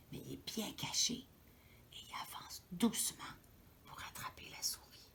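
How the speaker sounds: soft, low, sneaky whisper; storyteller whispering the sneaking cat; suspense whisper. A narrator's reading. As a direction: whisper as if the cat could hear.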